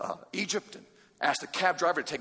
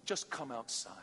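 softer, gentle call; soft invitation; 5 semitones lower, 11 dB quieter than usual. Pretend. Jesus calling the listener outside, soft. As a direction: invite them tenderly.

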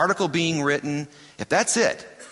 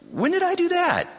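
a sudden squeal of disbelief, pitched much higher; shocked. Retold or imitated. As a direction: jump high in shocked disbelief. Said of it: "they're gonna be shocked".

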